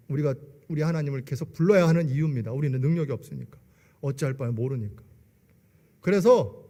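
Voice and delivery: normal talking voice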